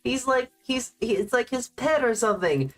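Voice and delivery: high gushing coo; high mock-sweet fawning; cooing over cute. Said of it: "'Cause, like".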